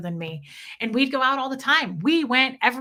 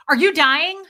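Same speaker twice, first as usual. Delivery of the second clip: loud, high mock alarm; teasing dark joke; 6 semitones higher, 9 dB louder than usual. Wife teasing her coughing husband, mock alarm. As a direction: needle him with a grin. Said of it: "'Cause I'd go".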